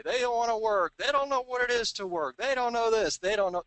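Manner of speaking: high, loud imitation of complainers; high-pitched mocking lament; mock bemoaning.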